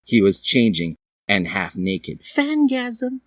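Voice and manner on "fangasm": fangirl squeal. Acted. Fangirl reaction.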